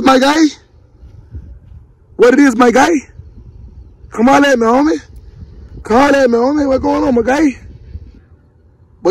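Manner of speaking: high, sing-song voice for the dog; high playful pet voice; dog talk. Real, not acted. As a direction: coo at the pup like a buddy. Talking baby-talk to the dogs.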